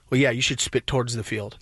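very low mock-husband voice; husband's reply. Husband answering about spitting, low put-on voice. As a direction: drop way down to imitate him. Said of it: "To which he then replies".

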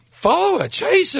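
very high urgent squeak; mock urgent prompting. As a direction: urge him on in a high put-on voice. [name] urging him after her, acted.

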